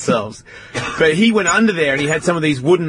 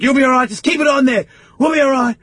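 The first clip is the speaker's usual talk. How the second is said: urgent reassurance; 12 semitones higher, 3 dB louder than usual. Retold or imitated. Reassuring brother while running.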